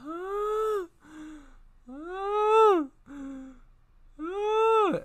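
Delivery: high, loud imitated wailing screams; mock wailing. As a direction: wail like a crowd in unison. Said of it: "start crying and screaming".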